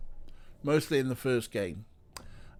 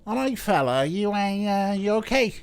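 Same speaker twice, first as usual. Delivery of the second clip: higher-pitched, badly faked Scouse; bad Scouse accent; 9 semitones higher, 6 dB louder than usual. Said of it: "I'm doing a bad Scouse".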